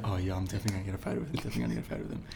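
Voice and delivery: low, rapid mutter under the breath; muttering to himself. Retold or imitated. Fan to himself before meeting his idol, muttered low and fast. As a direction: quietly reassure yourself in a low, quick voice. Telling himself he'll get a photo.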